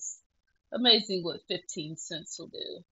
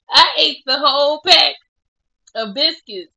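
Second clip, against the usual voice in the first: loud, higher laugh breaking into tears; laugh-crying confession; 6 semitones higher, 11 dB louder than usual. Real, not acted. Vlogger admitting she ate the biscuits, laughing and crying loudly.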